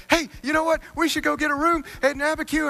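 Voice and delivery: bouncy high voice pitching an idea; Billy's eager suggestion. Retold or imitated. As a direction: pitch way up like a wired, cheerful racer.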